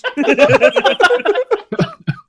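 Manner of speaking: long high cackle; high laughter. Real, not acted. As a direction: break into shrieking laughter. Laughing at the croissant.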